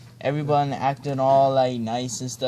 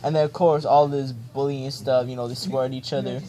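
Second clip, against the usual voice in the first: normal talking voice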